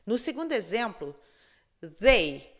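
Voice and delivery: ordinary delivery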